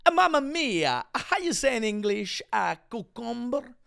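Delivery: high-pitched cartoon Italian accent; mock Italian accent. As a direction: let the fake Spanish slip into hammed-up Italian. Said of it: "Am, am I doing Italian?".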